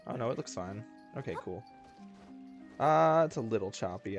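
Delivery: ordinary talk, nothing marked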